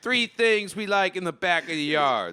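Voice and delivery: hammed-up Chicago accent; bad Chicago impression, half-laughing; lightly done Chicago accent; terrible Chicago accent. Shown as a demonstration. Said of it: "This is a terrible Chicago accent".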